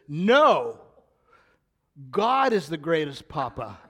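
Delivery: loud, emphatic NO, then a declaration; lightly voiced child setting grandpa straight, loudly; granddaughter's firm correction. Eight-year-old pointing at grandpa's chest to correct him, emphatic. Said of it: "and she says".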